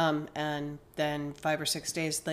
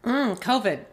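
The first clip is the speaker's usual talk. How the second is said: ordinary talk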